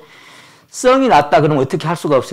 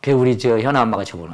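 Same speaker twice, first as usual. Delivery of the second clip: normal talking voice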